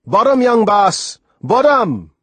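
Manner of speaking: high, loud, drawn-out West Indian holler; excited yell. A narrator's reading. Sailor calls to the boy on the raft, yelling. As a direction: call out the discovery across the water. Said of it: "Soon he yelled".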